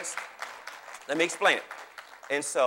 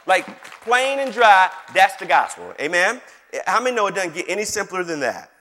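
loud, higher-pitched gospel preaching; full-force preaching; 7 semitones higher, 11 dB louder than usual. Real, not acted. Preacher proclaiming the good news to the congregation, full-force preaching. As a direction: preach it out with punched key words.

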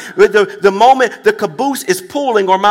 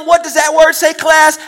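calling out; 5 semitones higher than usual. Real, not acted. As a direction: shout it out to the people. Preacher calls out to congregation.

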